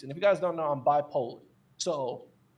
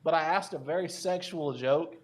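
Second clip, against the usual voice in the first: normal talking voice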